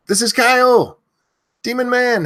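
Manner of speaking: high, loud shout of the name; screaming a name. Real, not acted. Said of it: "If you want me to scream your name".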